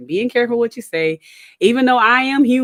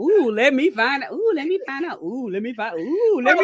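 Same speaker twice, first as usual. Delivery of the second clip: higher, lightly mimicking sing-song; repeating friend; 5 semitones higher than usual. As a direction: echo the phrase like a delighted learner. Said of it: "she over there like".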